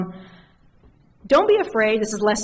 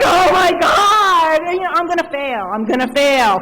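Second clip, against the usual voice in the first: high, loud half-crying wail; mock wailing panic; 9 semitones higher, 9 dB louder than usual. Told no by her boss, wailing high and loud. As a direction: ham up the terror of failing.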